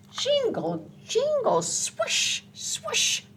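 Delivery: high squeaky jingle, then a breathy swoosh; squeaky sleigh sounds. Acted. Reading to a child, squeaky high sleigh sounds. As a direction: squeal the bells and hiss the swoosh as it speeds.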